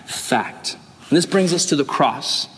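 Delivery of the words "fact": softly whispered single word. Acted. Pastor punctuating promises, whispered. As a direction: drop to a hush for emphasis.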